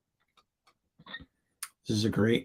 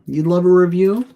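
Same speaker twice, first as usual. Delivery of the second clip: much higher and louder, "review?" swinging up; surprised echo; 8 semitones higher, 10 dB louder than usual. Acted. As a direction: toss the request back in mock surprise. Echoing the viewer's request back.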